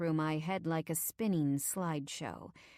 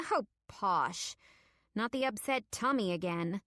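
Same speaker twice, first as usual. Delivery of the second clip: mildly weary, dismissive wife; exasperated sigh; 4 semitones higher than usual. A narrator's reading. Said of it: "she sighs".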